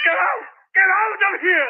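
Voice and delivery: high, loud yell to leave; furious shout. Acted. Tenant screaming at the policeman to leave, furious yell. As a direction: drive him out.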